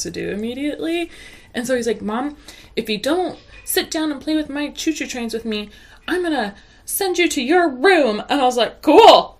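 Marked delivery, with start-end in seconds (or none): 2.0-8.2 put-on bossy kid threat. Son's empty threat voiced. 8.8-9.4 sarcastic. Retold or imitated. Sarcastic retold reply.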